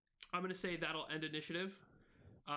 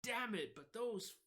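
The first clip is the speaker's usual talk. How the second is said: higher, fed-up grumble about kobolds; frustrated cursing; 6 semitones higher than usual. Acted. Izzy curses the kobolds, higher and fed up. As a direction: curse the bad luck in character. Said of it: "he's like".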